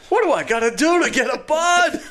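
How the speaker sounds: very high-pitched, hammed-up holler; mock exasperated yell. Man re-enacting his plea for a Bud, mock yelling. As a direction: plead loudly in comic desperation.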